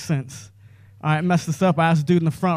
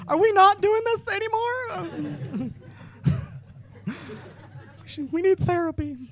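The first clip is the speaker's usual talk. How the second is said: much higher, soft slow laughing whine; mock couple whine; 13 semitones higher, 6 dB quieter than usual. A couple after the show, high soft whine. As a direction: whimper it like a worried partner.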